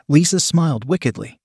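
plain reading voice

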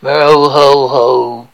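very low, slow, lifeless festive greeting; Father Christmas. Acted. Offering the worst one ever for Christmas improv, low slow droning. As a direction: do a hopelessly bad, droning Santa. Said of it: "like the worst Father Christmas you've ever come across".

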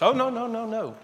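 high-pitched run of refusals; hammed-up, high protest; mock insistent objection. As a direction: imitate someone brushing off an argument. Stranger rejecting the pastor's answer.

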